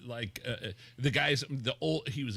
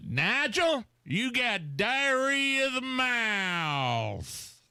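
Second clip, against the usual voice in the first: high, slow, drawn-out mock yell; yelling substitute teacher; 12 semitones higher, 3 dB louder, much slower than usual. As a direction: imitate an old teacher yelling at a pupil. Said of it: "teacher yelling at me in sixth grade".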